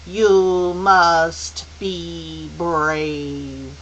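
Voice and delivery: slow, weighty pronouncement; solemn elder. Acted. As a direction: stretch every word out long. Mrs Which speaking solemnly, very slowly.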